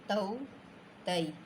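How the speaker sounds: तऊ and तई glided smoothly, no jerk; तऊ तई said smoothly, no jolt; smooth diphthongs. Shown as a demonstration. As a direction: read तऊ, तई soft and even without a catch. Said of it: "ऐसे पढ़ना है".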